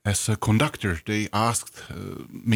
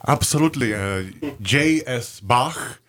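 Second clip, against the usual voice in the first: Czech accent; 2 semitones higher than usual. Real, not acted. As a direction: say Bach the way a Czech speaker does. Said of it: "can you say that again for me".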